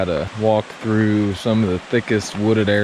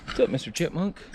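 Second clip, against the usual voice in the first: talking to a critter; 4 semitones higher, 10 dB quieter than usual. Real, not acted. Talking to a chipmunk.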